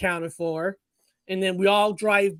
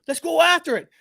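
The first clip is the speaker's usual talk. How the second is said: higher, louder keen call to chase; eager rallying cry; 8 semitones higher, 5 dB louder than usual. Retold or imitated. Young cousins urging each other to chase the creature, excited. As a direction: fire the others up to run after it.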